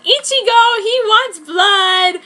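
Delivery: high, loud happy call; happily. A narrator's reading. Waitress calls to Ichigo about the vampire, happy and loud. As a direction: call it out brightly to Ichigo, slow on the last words. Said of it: "I said happily".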